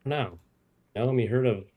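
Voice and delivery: usual speaking voice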